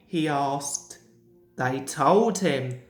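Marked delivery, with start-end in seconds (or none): none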